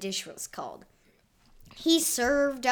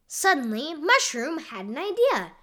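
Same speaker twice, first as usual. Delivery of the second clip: plain reading voice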